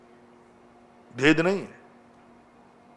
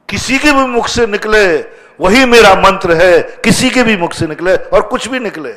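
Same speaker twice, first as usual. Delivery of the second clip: loud, raised-pitch proclamation; emphatic preaching; 6 semitones higher, 8 dB louder than usual. Real, not acted. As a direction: declare it forcefully to the congregation. Preaches at full force. (ऊँची आवाज़ में जोरदार प्रवचन)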